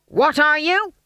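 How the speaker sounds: high, loud question; drill sergeant demand. A narrator's reading. Stage manager stumping along the line, loudly demanding. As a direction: bark the challenge.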